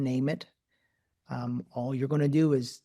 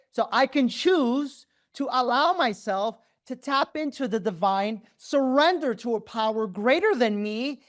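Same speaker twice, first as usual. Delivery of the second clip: much higher, ecstatic pretend breakthrough; high ecstatic mock revelation; 10 semitones higher, 3 dB louder than usual. Guru hams up a follower's breakthrough, high and ecstatic. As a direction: play the thrilled convert who finally gets it.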